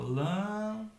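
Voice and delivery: LÂMPADA slowly split, LÂM held long; separated syllables. Shown as a demonstration. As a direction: stretch LÂM, then place pa and da apart. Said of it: "eu separo". (lâmpada separada, sílaba por sílaba)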